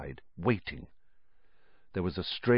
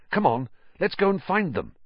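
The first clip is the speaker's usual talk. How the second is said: eager urging; 8 semitones higher, faster than usual. A narrator's reading. Urging friend to move on.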